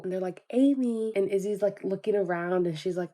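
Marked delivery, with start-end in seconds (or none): 0.5-1.1 mock barista call, pitched up. Barista calling for Amy's coffee, calling out. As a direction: call a customer's name across the shop. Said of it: "the barista is there calling for Amy".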